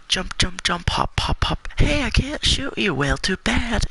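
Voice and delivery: high-pitched, bouncy mock gloating; playful taunting. Gamer re-enacting how he hopped around his dad, high playful taunting. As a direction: chirp it high, teasing the player you dodge. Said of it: "I'd be all like".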